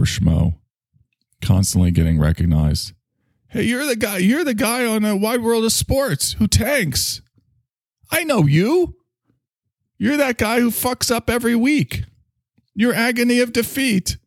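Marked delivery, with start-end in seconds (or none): 3.5-14.2 high, nasal-sounding taunting fan voice, much higher; mocking fan. Stranger taunting the ski-jump loser in a high mocking voice. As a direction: squeal it out like an obnoxious fan.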